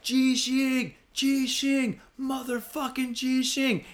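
high, frantic calling out; waving him down, yelling the warning; yelling earthquake at the driver. Retold or imitated.

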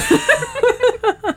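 a high squeal of laughter; delighted squealing laugh. Real, not acted. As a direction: let a high laugh fly. Laughing at the couple.

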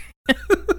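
high giggling burst; can't stop giggling; giggle. Real, not acted. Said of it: "Still giggly".

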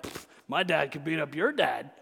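mock schoolyard boast; a hint of a child bragging; playground kid taunt. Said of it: "and be like".

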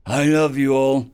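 slow, loud, choked-up rambling; tearful drunk. Acted. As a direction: blubber it out, halting and sniffling. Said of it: "said with a sniffle".

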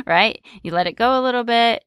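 usual speaking voice

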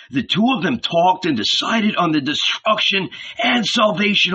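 excited Philip. A narrator's reading. Philip tells Nathanael the world is ending, awed and urgent. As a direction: pour out the marvels, awed and hurried.

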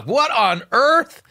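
high, drawn-out rising disbelief; incredulous. Real, not acted. Host reacting to worshippers calling her Mama, incredulous. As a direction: stretch it upward, utterly baffled.

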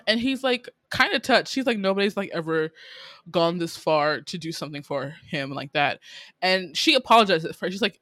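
ordinary talk, nothing marked

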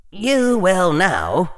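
high, very slow command; stern inner voice. A narrator's reading. Hufflepuff part inside Harry's head ordering him, stern and drawn-out. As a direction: draw it out as a firm warning. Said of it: "said the voice of Hufflepuff inside his head".

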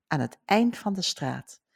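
normal talking voice

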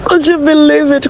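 high, thrilled disbelief; could you believe it, squealed with delight; excited. Real, not acted. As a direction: burst out with real joy. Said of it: "I'm so excited".

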